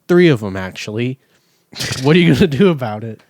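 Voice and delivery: loud and fast, daring them; defiant taunt. Real, not acted. Taunting the delivery company over stolen tablets, loud defiance. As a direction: throw the challenge out loud, quick and cocky.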